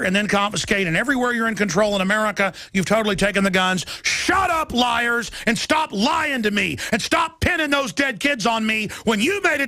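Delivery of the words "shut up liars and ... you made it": yelling it high and hard at the liars; furious, spitting the accusation; angry outburst. Real, not acted. Host yelling at gun-control politicians, furious.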